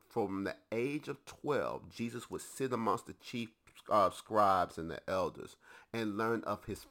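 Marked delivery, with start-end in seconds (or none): none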